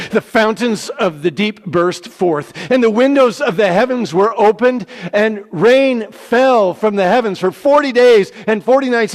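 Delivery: loud, high, full-throated narration; dramatic loud reading. A narrator's reading. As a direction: read the flood bursting forth with all your force. Flood narrated with rising drama.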